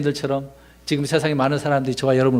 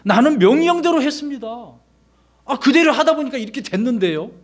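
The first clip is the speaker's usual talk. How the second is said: much higher, sure answer of a man who obeyed; confident witness; 10 semitones higher than usual. Retold or imitated. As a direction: lift the voice high and answer without apology. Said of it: "그것이 아니죠". (훨씬 높은 목소리로 당당하게 대답)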